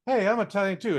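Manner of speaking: put-on eager kid. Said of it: "and he'd be like".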